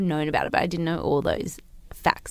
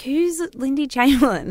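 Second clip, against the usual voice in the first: puzzled question; 7 semitones higher, 5 dB louder than usual. Retold or imitated. Confused question to Mum.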